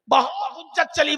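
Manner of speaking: ordinary talk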